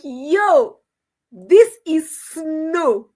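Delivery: "snow" drawn out, high and loud; loud, slow mock announcement stretching "snow"; Nasto's snow pronunciation. Mocking how a man calls snow, "snow" high and stretched. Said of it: "The way he's calling snow".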